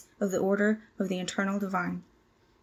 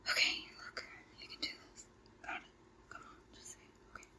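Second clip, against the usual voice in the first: whispered pep talk; 20 dB quieter, faster than usual. Real, not acted.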